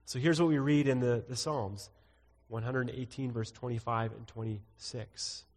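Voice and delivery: ordinary talk, nothing marked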